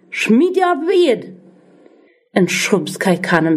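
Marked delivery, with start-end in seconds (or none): 0.1-1.3 higher, forceful yell of an angry father; furious father shouting at his son to throw it away. A narrator's reading. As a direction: bark the order at the boy. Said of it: "Heu brell".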